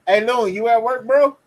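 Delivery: loud call to a friend. Real, not acted. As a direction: shout across to get his attention. Calling friend over call.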